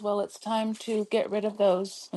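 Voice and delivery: ordinary talk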